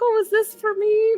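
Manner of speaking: innocent high voice. Acted. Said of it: "just be like".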